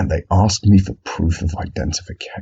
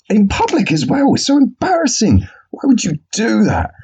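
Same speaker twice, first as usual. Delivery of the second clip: exasperated; 14 semitones higher than usual. Real, not acted. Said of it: "exasperated rant".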